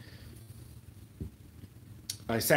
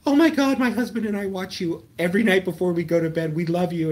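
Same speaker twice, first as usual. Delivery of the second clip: high, loud gushing fan; excited squeal of recognition, acted out; 7 semitones higher, 6 dB louder than usual. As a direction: imitate a thrilled fan gushing. Retold excited fan recognizing him.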